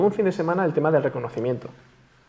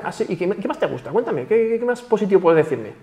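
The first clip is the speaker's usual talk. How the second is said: much higher, bright and eager, fishing for more praise; 5 semitones higher than usual. Shown as a demonstration. Answering a compliment, asking for more, in a high eager voice. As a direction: act delighted and hungry for compliments.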